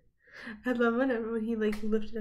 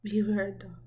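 ordinary delivery

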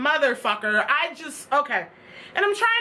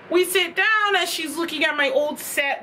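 ordinary talk, nothing marked

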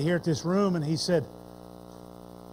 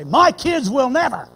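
raised, insistent parent's vow; a loud "My kids will never" with stress on "my"; proud vow; 3 semitones higher, 6 dB louder than usual. Retold or imitated. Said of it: "the same tone of voice".

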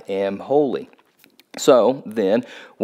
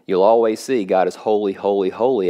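ordinary talk, nothing marked

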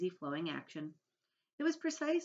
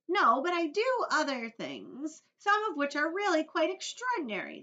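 Earthworm; 12 semitones higher, 9 dB louder than usual. A narrator's reading. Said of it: "that was the Earthworm".

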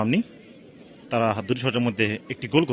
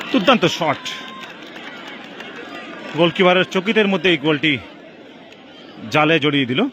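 excited goal call; 4 semitones higher than usual. Real, not acted. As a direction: lift the voice as the ball hits the net. Goal scored, live call.

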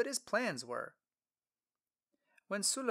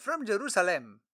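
higher, louder friendly traveler; Philip voice; 6 semitones higher, 7 dB louder than usual. Acted. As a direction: introduce yourself warmly.

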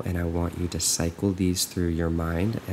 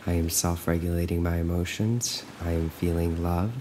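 calm, even affirmations with long silences between; guided meditation; 6 dB quieter than usual. Acted. Coach looping affirmations for listeners to follow, calm and measured. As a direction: recite evenly, leaving room to repeat.

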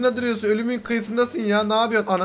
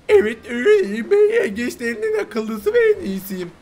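a much higher, boastful crab voice; cocky crab voice; 9 semitones higher than usual. Pretend. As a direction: puff yourself up as the smartest crab. Voicing the new crab's reply. (kendini beğenmiş tiz yengeç sesi)

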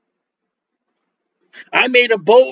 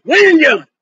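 louder, higher, indignant repeat of the question; lady demanding; 8 semitones higher, 6 dB louder than usual. Retold or imitated. Little lady confronting the preacher, louder and higher. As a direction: snap the question back at him, higher and louder. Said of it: "This little lady".

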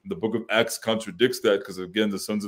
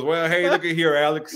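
higher, folksy pastor voice; Pastor Bob voice; 6 semitones higher than usual. Retold or imitated. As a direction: play him calling on the phone. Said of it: "He calls me and goes".

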